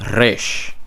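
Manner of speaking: Resh with a trilled, rolled R; rolling R. Shown as a demonstration. Teacher demonstrating rolled Resh. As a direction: roll the R of Resh. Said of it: "different than modern Hebrew".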